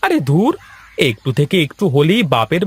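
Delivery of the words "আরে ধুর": high exasperated scoff. Acted. Husband fed up with wife's threats, a high exasperated scoff.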